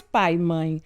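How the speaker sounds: normal talking voice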